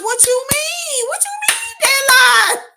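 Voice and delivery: very high, rising to a loud cry; can't believe what she just read; incredulous shriek. Retold or imitated. Answering a commenter about the album, disbelieving shriek. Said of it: "I'm like".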